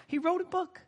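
exasperated punchline. Acted. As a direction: say it like it's so obvious.

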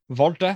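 Walter said the German way, with a V sound; German pronunciation. Shown as a demonstration. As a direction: say Walter as "Valter", German style. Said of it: "in German it's Walter".

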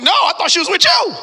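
high, loud alarmed retort; alarmed echo. Retold or imitated. Father answers wife in high, loud alarm. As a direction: throw it back in rising panic.

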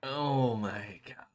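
slow stunned whisper. Real, not acted. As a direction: breathe it out in disbelief. Reacting to Christmas music news.

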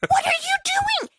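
shrill, high disbelief; exasperated outburst. Acted. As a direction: throw the question up in outrage. Ranting at slow left-lane drivers.

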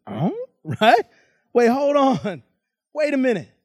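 taken-aback "oh, right?"; confused protest, lightly acted; mildly acted disbelief. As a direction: stop them, not believing the news. Older brother reacts in disbelief.

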